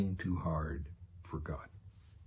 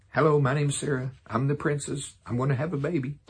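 higher and louder, a lightly hammed-up proud old woman; mock Sarah; 6 semitones higher, 5 dB louder than usual. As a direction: announce it like a proud princess. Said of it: "Can you imagine her?".

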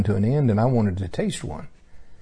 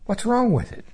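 high puzzled question; 12 semitones higher than usual. Retold or imitated. Asking God about the tree.

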